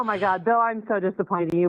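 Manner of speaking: normal talking voice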